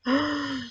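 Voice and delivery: a quick, soft, mock-alarmed gasp; small pretend gasp at the thunder. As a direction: gasp softly as if the storm frightens you. Storm story, mock alarm.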